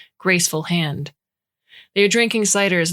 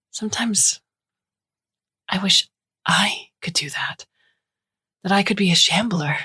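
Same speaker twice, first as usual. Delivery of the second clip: confessional whisper; 4 semitones higher than usual. A narrator's reading. Alea whispering her secret wish to Liz. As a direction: breathe it out like a secret.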